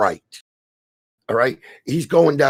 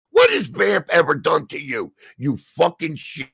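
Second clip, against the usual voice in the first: usual speaking voice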